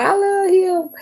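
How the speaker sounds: high, swooning, lovesick whine; mock the one who can't let go. Clinging lover sighing over her ex, mock swoon.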